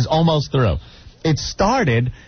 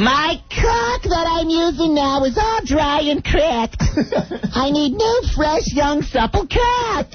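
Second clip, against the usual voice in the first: high, slow mock Boston old-lady voice; Boston accent; 13 semitones higher, slower than usual. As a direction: mimic the mother-in-law with a Boston accent. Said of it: "with the Boston accents".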